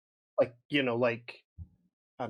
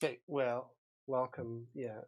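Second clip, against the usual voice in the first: ordinary talk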